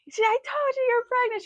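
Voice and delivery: very high, thrilled gloating; excited sister. Retold or imitated. Sister opens the box and squeals in triumph, very high. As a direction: squeal it, I knew it all along. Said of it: "She was so excited".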